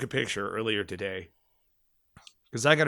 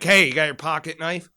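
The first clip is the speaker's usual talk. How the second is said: higher, louder call up to a buddy; retold scream up the cliff; 5 semitones higher, 7 dB louder than usual. Yelling up to the man on the rope, retold shout. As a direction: shout it up the cliff face, a bit held back. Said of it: "I scream out to him".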